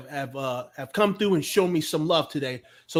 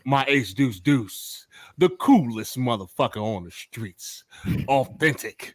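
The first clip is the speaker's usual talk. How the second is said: smooth host voice; 8 semitones lower than usual. Acted. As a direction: lay it on low and smooth like a radio host. Acts smooth radio host voice.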